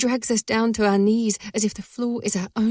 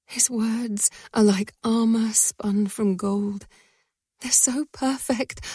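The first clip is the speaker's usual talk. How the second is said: ordinary delivery, nothing marked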